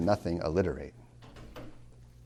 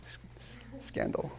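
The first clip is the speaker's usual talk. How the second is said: mock scandalized whisper; 11 semitones higher, 9 dB quieter than usual. Mock shock at preferring Frost.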